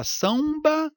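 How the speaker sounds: "samba" com ditongo, sãum-ba; pronúncia errada. Shown as a demonstration. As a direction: suba e deslize a nasal de "samba" até um U. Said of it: "você fala samba ou". ("samba" com semivogal U no meio)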